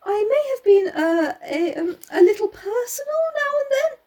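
high, halting hesitant voice; nervous stammer. Acted. Hastings confessing to Poirot, nervous stammer. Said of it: "I stammered".

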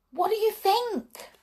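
high eager question; bubbling over with a secret; high teasing excitement; excited. A narrator's reading. Princess teasing news.

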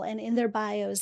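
normal talking voice

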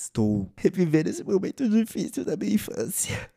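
high-pitched, hammed-up weepy voice; mock tearful. Storyteller reliving a childhood snub, mock tearful. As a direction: pretend to choke up melodramatically. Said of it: "com água nos meus olhos".

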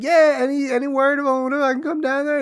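very high hopeful squeaky ask; eager Eddie. Retold or imitated. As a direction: beg to come along in a high voice. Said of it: "And he's like".

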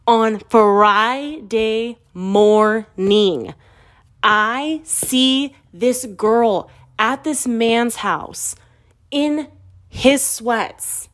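very slow, syllable by syllable for emphasis; dramatic slow reveal. Real, not acted. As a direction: draw out each word of the scandalous reveal. Drawn-out reveal of the betrayal.

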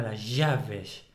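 las llaves in an Argentine accent, with the ll of llaves as a sh sound. Shown as a demonstration. Contrasting Spain with Argentina for a friend, Argentine accent. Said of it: "y en Argentina".

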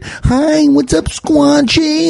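put-on high, slow sing-song baby talk; high, slow cooing at the stray cat. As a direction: coo like a silly cat lover. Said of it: "I talk like an idiot".

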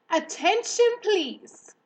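high, loud call for attention; loud, firm voice. A narrator's reading. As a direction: announce it firmly to the whole barn. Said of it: "in a loud, firm voice".

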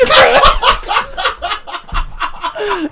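big shrieking laugh. Real, not acted. Friends laughing at story.

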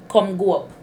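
"come go up" run together in a Jamaican lilt; Jamaican patois. Shown as a demonstration. Teaching her friend patois, in a Jamaican accent. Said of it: "the Jamaican word for that would be".

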